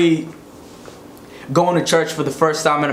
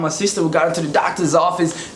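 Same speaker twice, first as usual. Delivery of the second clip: ordinary talk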